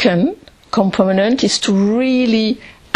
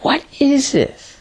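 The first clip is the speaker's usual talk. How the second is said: slow, higher "What is this?", let go into calm; question thrown calmly; 6 semitones higher than usual. Shown as a demonstration. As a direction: toss the question out slowly and let it rest. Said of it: "we could just throw the question".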